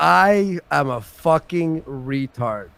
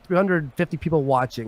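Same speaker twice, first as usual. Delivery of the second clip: ordinary talk, nothing marked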